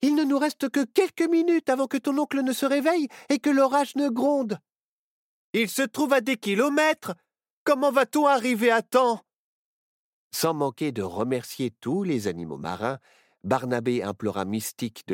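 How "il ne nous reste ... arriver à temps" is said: high, raised anxious voice; urgent worry. A narrator's reading. Child worries they will not return in time, anxious. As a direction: fret urgently about running out of time.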